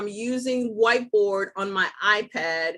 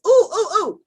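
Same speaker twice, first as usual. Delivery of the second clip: high, loud delighted ooh-ing; excited ooh; 11 semitones higher, 9 dB louder than usual. Real, not acted. Host delighted at a file she remembered, squealing ooh. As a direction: squeal over finding the file. Finds another file to airdrop.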